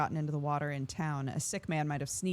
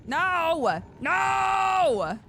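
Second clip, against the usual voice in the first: much higher, loud panicked shout; 12 semitones higher, 8 dB louder than usual. Real, not acted. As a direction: yell it in real alarm. Panic in game.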